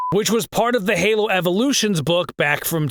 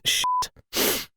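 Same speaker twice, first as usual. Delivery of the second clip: usual speaking voice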